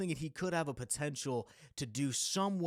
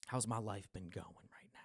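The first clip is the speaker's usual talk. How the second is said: whispering; 3 semitones lower, 6 dB quieter than usual. Real, not acted. Muttering to himself, thinking aloud.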